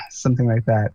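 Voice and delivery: ordinary talk, nothing marked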